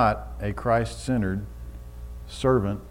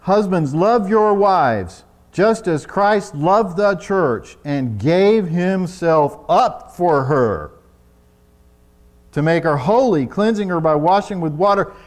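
louder, slow, preached reading; preaching scripture; 5 semitones higher, 9 dB louder, slower than usual. Acted. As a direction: proclaim the verse to the husbands. Reading scripture at full force.

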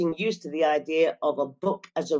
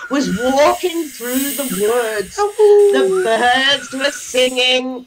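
slow, louder, higher story narration; storytelling voice; 6 semitones higher, 5 dB louder, slower than usual. Acted. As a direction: draw the class into the tale slowly. Teacher tells the story slowly.

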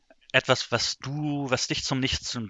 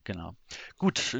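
normal talking voice

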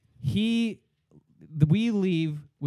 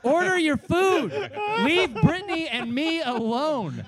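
put-on high, loud, whining drunk; drunk whine; 10 semitones higher, 5 dB louder than usual. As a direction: plead like a weepy drunk. Drunk friend shoos him away.

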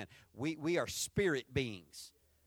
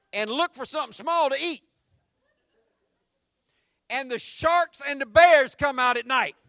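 mock scary warning; 8 semitones higher, 13 dB louder than usual. Scaring son with made-up dangers.

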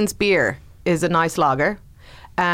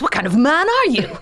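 mock outrage; 7 semitones higher than usual. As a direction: play up the shock at him.

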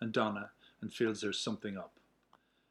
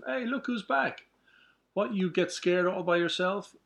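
teasing; 7 semitones higher, 4 dB louder than usual. Acted. Teasing a returning friend.

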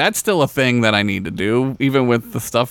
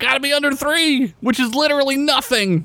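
a much higher, squeaky mock voice; 13 semitones higher than usual. Joking with a friend about airport rules, squeaky high mock voice.

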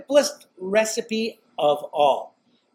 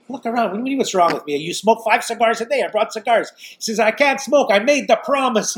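higher-pitched mock exasperation; imitated indignant uncle; 6 semitones higher, 4 dB louder than usual. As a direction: fire back like an offended uncle. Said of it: "He tells me like that".

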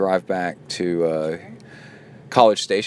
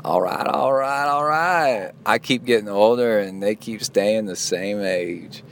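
Matthew McConaughey. Acted. Host quoting a movie star for laughs, drawn-out impression. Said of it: "Matthew McConaughey?".

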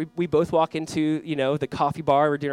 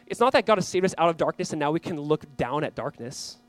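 usual speaking voice

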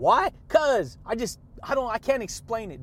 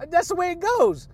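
much higher, louder exclamation; excited catchphrase; 9 semitones higher, 5 dB louder than usual. Real, not acted. Hyping his listeners with an excited exclamation. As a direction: sing it out with gusto.

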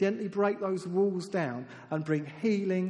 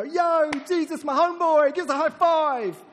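high, loud, stretched hollered hello; hammed-up yell to a best buddy; mock rowdy greeting; 11 semitones higher, 9 dB louder than usual. Someone greeting Jesus as a homeboy, mock exuberant shout.

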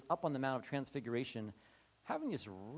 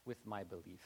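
soft, low honest plea; quiet plea; 7 semitones lower, 15 dB quieter than usual. Retold or imitated. Honest man asking for help, soft low plea. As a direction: say it barely above a breath.

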